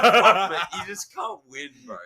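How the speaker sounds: high, mock-defeated cry; hammed-up "I give up" squeal; laughing exasperation. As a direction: throw up a protest through laughter.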